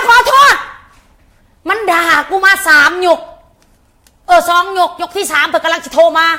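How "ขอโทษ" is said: high, loud mocking put-on voice of the caller; imitating the caller's apology. Mimicking the woman who phoned to apologise, high and loud. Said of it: "ก็ยังว่าขอโทษ เพิ่นว่า".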